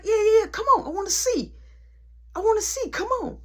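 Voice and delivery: high excited yelling come on; hyped taunting. Retold or imitated. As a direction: crank it up, daring him to swing. Taunting him to fight.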